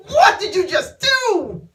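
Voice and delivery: loud, high, hammed-up shock; mock-scandalized high yell; shocked, disgusted. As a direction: imitate an appalled man, slow and shrieking. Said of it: "he's shocked, he's disgusted".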